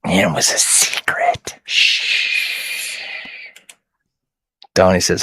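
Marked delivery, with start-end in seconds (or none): 0.0-3.0 hushed secret whisper. Real, not acted. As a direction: whisper it so nobody hears. Telling a secret to chat.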